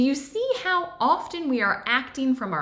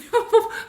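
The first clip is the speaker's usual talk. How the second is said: real laugh; 12 semitones higher, 6 dB louder than usual. Real, not acted. Laughs at her own slip.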